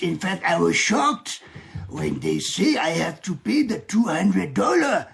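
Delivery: slow, hammed-up French accent. Shown as a demonstration. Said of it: "I can do a French accent".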